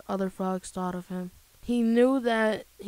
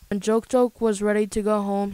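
ordinary delivery, nothing marked

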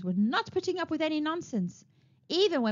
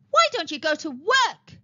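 raised, louder and higher demand; shouting; 5 semitones higher, 6 dB louder than usual. A narrator's reading. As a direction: shout it at the lazy lot. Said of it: "she shouted".

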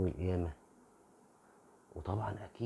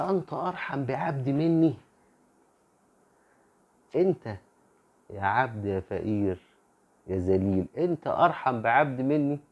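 higher, louder, thundering reproach; booming divine rebuke; 5 semitones higher, 9 dB louder than usual. Acted. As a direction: rebuke the servant in a booming, raised voice. God rebukes the servant. (توبيخ مدوٍّ بصوت عالٍ ومرتفع)

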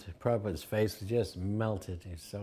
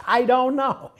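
playful reply; 13 semitones higher, 9 dB louder than usual. Retold or imitated. Prabhupada's playful answer.

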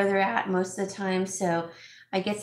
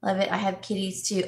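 usual speaking voice

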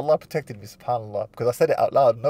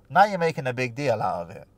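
normal talking voice

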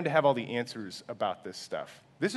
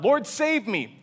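raised, high cry for help, read aloud; 9 semitones higher, 6 dB louder, slower than usual. A narrator's reading. Preacher reading Peter's plea as he sinks, loud cry. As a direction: call out in alarm as a reader would. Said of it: "he cried out".

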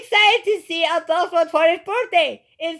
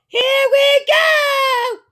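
slow, stretched-out higher shout; excited shout; 7 semitones higher, much slower than usual. Real, not acted. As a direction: belt it out like the show is starting. Kid kicks off the video.

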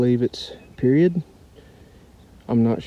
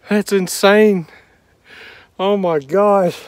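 excited; 6 semitones higher, 5 dB louder, much slower than usual. Real, not acted. Finds rare Confederate shell.

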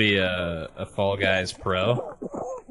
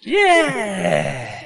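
excited cheer; 9 semitones higher than usual. Real, not acted. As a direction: cheer the win. Celebrating win.

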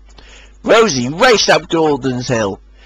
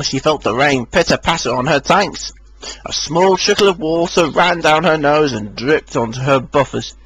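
usual speaking voice

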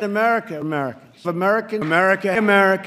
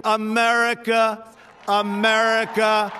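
drawn-out rally call; 6 semitones higher, slower than usual. Real, not acted. Speaker rallying the crowd, stretching out the name. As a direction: draw out America for the crowd.

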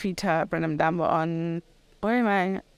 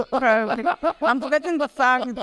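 speaking through real laughter; laughing; 6 semitones higher, 4 dB louder than usual. Real, not acted. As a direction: laugh while admitting she forgets. Laughing at host's joke.

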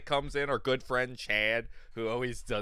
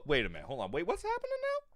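confused; faster than usual. Retold or imitated. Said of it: "it was more of a".

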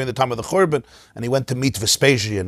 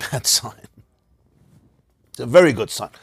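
ordinary talk

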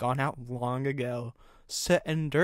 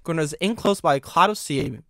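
ordinary delivery, nothing marked

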